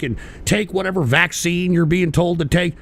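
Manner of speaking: normal talking voice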